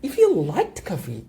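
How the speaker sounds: "if you liked coffee" said slowly, as if wishing; hypothetical wistful tone. Shown as a demonstration. Teacher to students, showing a wishful hypothetical tone. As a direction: say it like you know they don't. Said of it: "이 느낌을 가지면서 얘기해야 돼".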